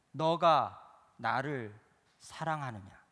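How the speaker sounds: drawn-out, slow questioning; slow repeated question. Acted. Preacher voicing Jesus asking Peter again, slow and drawn-out. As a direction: stretch each word as Jesus asks again.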